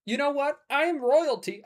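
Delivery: high, lightly regal declaration; lightly played king. Player imagines a threatened French king, lightly defiant. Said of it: "if I was a French king".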